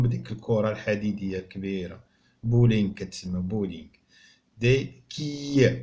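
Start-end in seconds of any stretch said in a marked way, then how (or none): 4.6-5.7 Des quilles drawn out slowly to count syllables; teacher modelling French; slow model word. Shown as a demonstration. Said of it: "شحال فيها من مقطع صوتي".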